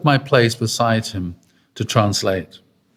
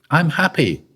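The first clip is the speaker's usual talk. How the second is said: Father's joyful reply; 6 semitones higher than usual. Retold or imitated. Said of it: "this is what Father said".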